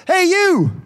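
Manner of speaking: high, loud call as God. Acted. Preacher voicing God calling to the reader, loud and high. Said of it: "this is God saying".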